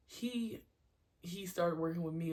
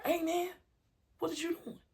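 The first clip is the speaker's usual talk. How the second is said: high, loud reproach; scolding yell; 12 semitones higher, 5 dB louder than usual. Shown as a demonstration. Coach questioning a kid's mistake, scolding yell. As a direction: call the kid out in exasperation. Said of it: "if I'm yelling at them".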